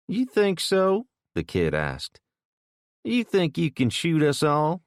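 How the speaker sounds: high, sneering challenge; taunting kid. A narrator's reading. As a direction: goad him with a sneer.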